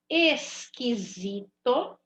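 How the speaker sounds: esquisito stretched slowly with a hard k and no U sound; slow teaching read. Shown as a demonstration. Teacher showing pupils a silent U, slow and clear. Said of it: "não tô ouvindo o som do U".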